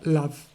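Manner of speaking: "love" with a clear initial L; light L. Shown as a demonstration. Teacher gives the contrasting example, light L in "love". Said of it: "a light L".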